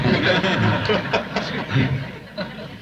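a warm high laugh at his own joke. Real, not acted. Preacher laughing at his quality joke. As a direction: laugh along with the crowd.

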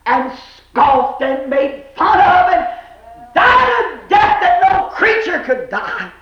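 preacher driving the words upward; impassioned preaching. Real, not acted. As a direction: build to a fervent cry. Preaches at full force.

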